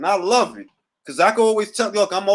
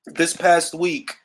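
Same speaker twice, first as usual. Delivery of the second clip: normal talking voice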